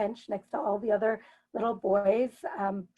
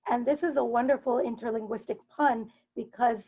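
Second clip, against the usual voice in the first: ordinary talk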